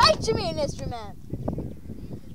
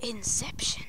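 whisper; 10 semitones lower, 14 dB quieter than usual. Pretend. Dramatic aside.

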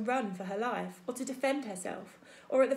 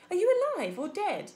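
higher, louder call down from the tree; 8 semitones higher, 7 dB louder than usual. Acted. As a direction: call down into the dark. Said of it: "The robot's voice called down".

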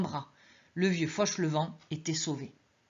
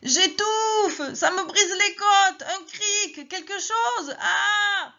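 put-on high, strained old man's howl, slow; crushed old man screaming for help; old man crying out in pain; 14 semitones higher, 5 dB louder, much slower than usual. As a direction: gasp and yell as if crushed. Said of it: "râlait et hurlait".

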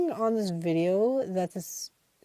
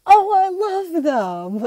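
much higher, slow, louder mock voice; mock exasperated gamer; 11 semitones higher, 10 dB louder, much slower than usual. YouTuber finding chickens in his room, high drawn-out mock voice. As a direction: drag out the name in fake dismay.

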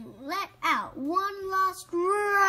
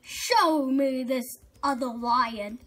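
angry lion; 5 dB louder than usual. Acted. Lion boasts angrily to rabbit.

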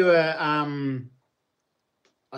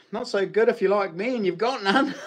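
laughing; 7 semitones higher, 3 dB louder than usual. Real, not acted. Joking about having no gold.

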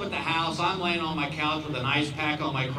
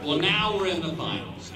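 ordinary talk

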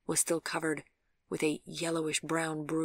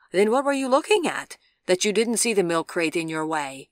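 challenging question; 3 semitones higher, 10 dB louder than usual. A narrator's reading. Injured girl questioning the cyclist who hit her, challenging. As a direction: demand an explanation, incredulous.

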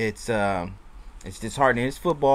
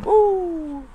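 happy woo. Real, not acted. Reacting to his drink.

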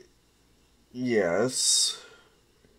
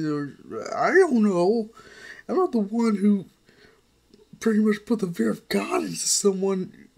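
put-on higher girl voice, exasperated and a bit louder; a niece lecturing her uncle, pitched up; exasperated young girl; 10 semitones higher, 3 dB louder than usual. Melissa scolding continues.

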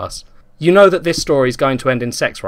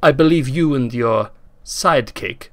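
a lightly voiced Russian accent, slow; menacing villain in a mild Russian accent; heavily accented. Russian kidnapper on the intercom, in a mild Russian accent. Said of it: "said a heavily accented voice".

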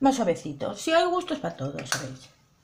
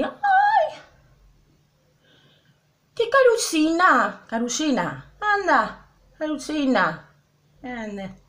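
put-on cooing at a toddler; 3 semitones higher, slower than usual. Mocking people who coo at her bare face, baby talk. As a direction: coo it like to a two-year-old. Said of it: "Que fuera una niña de dos años".

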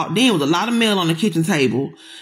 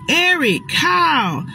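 calling out; 8 semitones higher, much slower than usual. Retold or imitated. Calling names in woods.

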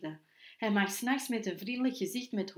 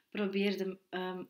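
ordinary delivery, nothing marked

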